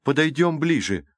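eager exclamation. A narrator's reading. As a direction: urge the others onward. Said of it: "воскликнула Калипсо". (взволнованный призыв)

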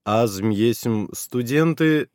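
slow halting broken Bulgarian; broken Russian-Bulgarian. Acted. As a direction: spell it out word by word. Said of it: "сказал я на ломаном русско-болгарском".

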